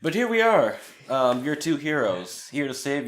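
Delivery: loud, high showman announcement. Acted. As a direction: present yourselves like stage heroes. Hammy hero introduction.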